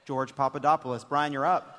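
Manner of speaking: normal talking voice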